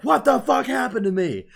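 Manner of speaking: high, loud mock-panicked shout; imitated freak-out. Hero waking to busted arms, imitated freak-out. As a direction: shout it in outraged disbelief, hammed-up. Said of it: "he's freaking the fuck out".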